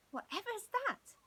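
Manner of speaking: high, startled question. A narrator's reading. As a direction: jump awake and ask. Woken by screech.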